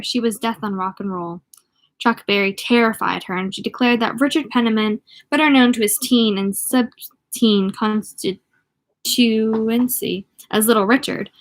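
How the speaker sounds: ordinary delivery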